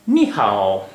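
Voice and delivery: "नी हाओ" said in Mandarin, ni falling-rising, hao falling; Chinese greeting. Shown as a demonstration. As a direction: model the Chinese "नी हाओ" for students. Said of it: "यानी सलाम".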